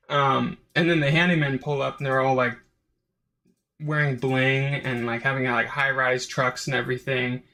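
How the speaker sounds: usual speaking voice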